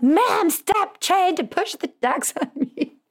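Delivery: very high, drawn-out hammed-up outburst; exaggerated high-pitched mock telling-off; mock kid's yelling. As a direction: imitate a daughter's exasperated screech, breaking into laughter. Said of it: "I just got yelled at".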